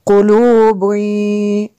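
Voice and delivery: slow chanted "कुलूबुई" ending in a held nasal y; ghunnah with merging. Shown as a demonstration. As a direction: hum through the drawn-out end of "कुलूबुई". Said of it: "इसलिए हमने गुन्ना किया".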